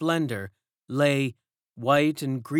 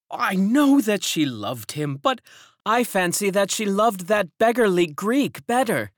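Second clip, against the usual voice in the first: raised, loud scornful woman; gossiping servant; 8 semitones higher, 5 dB louder than usual. A narrator's reading. Servant gossips about the nurse and the Greek, raised and scornful. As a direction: sneer at the Greek.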